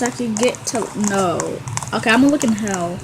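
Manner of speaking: normal talking voice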